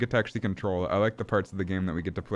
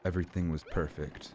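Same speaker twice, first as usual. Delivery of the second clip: hushed, low scene-painting; serene narration; 4 semitones lower, 7 dB quieter than usual. Pretend. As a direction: narrate dreamily, dropping softer as you go.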